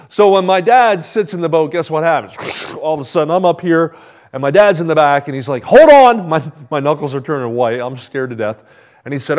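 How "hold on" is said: loud urgent yell, much higher; yelled warning. Retold or imitated. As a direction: shout it over the rushing water. Dad yells as boat launches.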